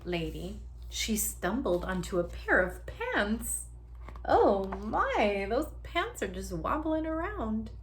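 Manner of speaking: ordinary delivery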